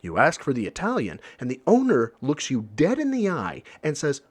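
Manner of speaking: ordinary talk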